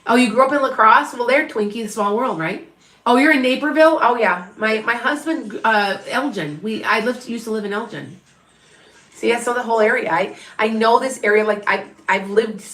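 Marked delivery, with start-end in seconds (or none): none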